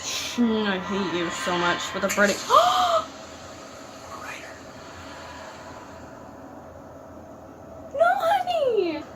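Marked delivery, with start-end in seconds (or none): 2.4-7.9 horrified gasp. Real, not acted. Horrified at the scene.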